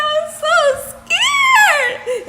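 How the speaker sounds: very high, drawn-out scared cry; hammed-up tearful squeal, half laughing; mock terrified wail. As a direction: wail it loudly like a big baby. Acts out her fear to husband.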